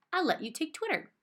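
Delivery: lightly high sweet grandma voice. A narrator's reading. Grandma offering her canary to the girl, sweetly high. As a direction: offer it as a kindly old grandma.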